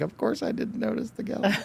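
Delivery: husband caught not noticing, sheepish and high. Retold or imitated. Retold sheepish reply to wife.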